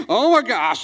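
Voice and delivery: high, loud alarmed yelp; exaggerated horror, as if about to bolt; mock panic. Acted pastor panics and flees.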